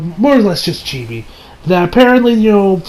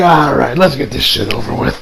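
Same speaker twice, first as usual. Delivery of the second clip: resigned reply; 6 semitones lower than usual. Acted. Voicing Kaneki's resigned reply.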